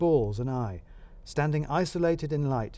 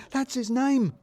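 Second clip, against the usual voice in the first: high, eager boss pointing something out; excited boss voice; 12 semitones higher, 3 dB louder, slower than usual. Acted. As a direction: pitch it up like an overeager manager. Balls insisting on the name.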